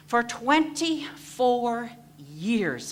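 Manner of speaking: "twenty-four years" drawn out slowly and high; slow emphasis. Real, not acted. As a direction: lean hard on "twenty-four years", each syllable slow. Stressing long struggle years.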